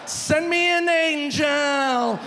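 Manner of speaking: high, loud, slow and dreamy; drunk blissful plea. Acted. Drunk man thanks his helper, high, slow and dreamy. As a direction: drag it out like a happy drunk. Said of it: "drunk's blissful reaction".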